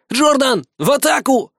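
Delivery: high-pitched exclamation; excited high command; boy's battle cry. A narrator's reading. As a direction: send your troops charging in a high boy's voice. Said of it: "воскликнул пацан".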